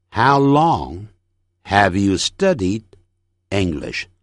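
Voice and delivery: slow, with pauses after each phrase; careful, separated sentence drill; phrase-by-phrase model. Shown as a demonstration. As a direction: break it into chunks for learners to repeat. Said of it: "整句练习".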